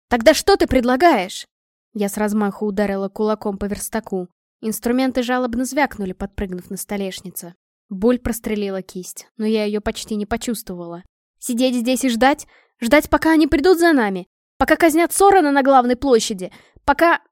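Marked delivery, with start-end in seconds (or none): 0.1-1.5 loud frustrated demand; angry outburst. Acted. Mei slams fist on workbench. 11.4-17.2 rising, louder and higher anger; escalating desperate shouting. Acted. Mei's desperate escalating outburst.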